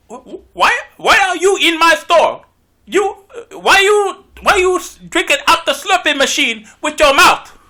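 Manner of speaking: loud, hammed-up Indian accent, slow in places. Convenience store clerk yelling at a customer, in a loud mock Indian accent. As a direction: play an angry Indian shop clerk, shouting. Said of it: "do like an Indian voice".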